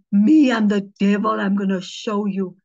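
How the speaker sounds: loud, low challenge; devil-possessed woman. Retold or imitated. Possessed woman challenging the pastor, retold as a loud threat. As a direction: throw it out as a forceful threat. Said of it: "She said".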